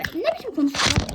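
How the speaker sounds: ordinary talk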